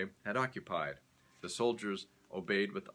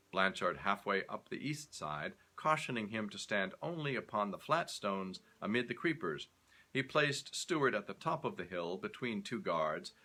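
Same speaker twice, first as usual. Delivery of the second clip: usual speaking voice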